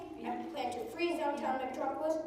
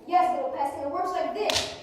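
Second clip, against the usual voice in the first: louder, rising gloat; triumphant villain shout; 4 semitones higher, 7 dB louder than usual. Acted. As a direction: crow over the trapped hero. Villain triumphs over hero.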